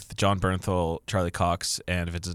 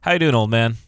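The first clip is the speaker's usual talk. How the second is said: put-on greeting; 2 semitones higher, 7 dB louder than usual.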